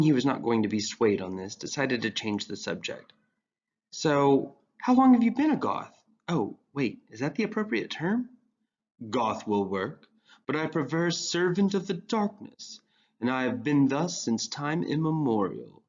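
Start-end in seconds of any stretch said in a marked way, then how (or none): 3.9-8.3 put-on higher, eager young voice; earnest teen boy. 9.1-15.8 breathy sage voice, slight wheeze. Acted. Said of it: "used his breathy voice again".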